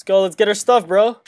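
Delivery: hyped up. Real, not acted. Hyped charge.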